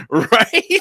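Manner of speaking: high, loud, laughing question; laughing agreement. Retold or imitated. Friends agreeing with the quip, laughing loudly.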